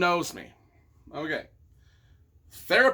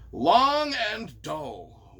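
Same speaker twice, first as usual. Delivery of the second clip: slow drawn-out verdict; much slower than usual. Retold or imitated. Reading one-star review slowly.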